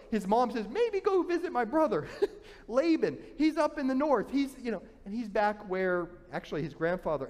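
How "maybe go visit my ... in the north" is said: high, laughing mother's voice; a mother laughing as she suggests her brother; mother's laughing advice. Acted. Mother advises fleeing Jacob.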